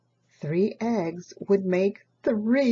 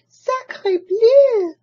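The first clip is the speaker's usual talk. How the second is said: high, loud duck voice; duck crying out; 13 semitones higher, 9 dB louder than usual. Acted. Duck finds the perfect nest, high loud exclamation. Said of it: "she cried".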